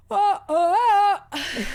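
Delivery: loud high yawning "oh, oh"; imitating dad's yawn. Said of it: "When my dad yawns, he goes".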